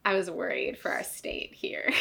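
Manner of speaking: hushed, breathy aside; voiceless confiding whisper. Real, not acted. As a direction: drop to a whisper as if not to be overheard. Whispered aside about their state.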